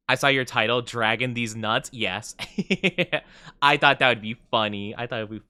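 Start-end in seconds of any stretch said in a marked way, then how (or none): none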